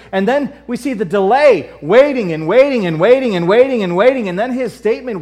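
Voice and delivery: loud, high, driving sermon delivery; impassioned preaching. Real, not acted. Preacher pressing the congregation about denial and delay, impassioned.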